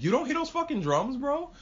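high-pitched incredulous question; alarmed disbelief. Retold or imitated. Scared guy asking companion about the drums, alarmed.

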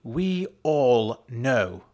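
slow, loud and firm; firm, drawn-out insistence. A narrator's reading. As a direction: weigh each word heavily. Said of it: "Rose repeated firmly".

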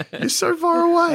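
high, laughing whine; giggly high complaint. Real, not acted. Teasing friend who slid away.

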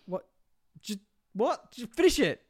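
high, loud, incredulous plea; mock-frantic urging, pitched well up; exasperated viewer. Viewer yelling at heroes who stop mid-fight, exasperated.

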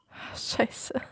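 tonlos geflüsterter Fluch; flüstere erschrocken vor dich hin. Retold or imitated. (erschrocken geflüstert)

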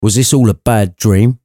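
plain reading voice

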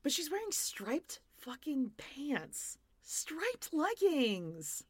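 slow, incredulous rise on the outfit complaint; outraged. Real, not acted. Host mocking the striped leggings, slow and incredulous. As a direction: draw it out in disbelief.

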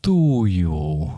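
lightly voiced slow vampire; a hint of a spooky vampire greeting. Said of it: "Do your vampire voice".

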